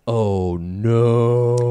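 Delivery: low, long drawn-out mock horror; dramatic dread. Reacting to the Deathlok reveal, mock dread. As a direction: stretch it out in hammed-up dismay.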